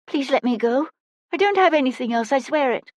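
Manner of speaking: put-on high, pleading child's voice; desperate high-pitched plea; frightened pleading. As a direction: beg the robbers to let you go. Robbed child pleads with attackers.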